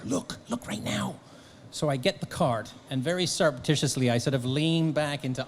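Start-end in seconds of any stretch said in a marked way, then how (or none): 0.0-1.2 hushed, voiceless urging; urgent whisper. Retold or imitated. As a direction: whisper it insistently, retelling the moment. Wife urgently pointing out star.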